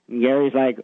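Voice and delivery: usual speaking voice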